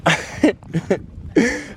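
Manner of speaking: high amused burst of laughter. Real, not acted. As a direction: break into a real laugh at the sight. Amused at Constantine's photo.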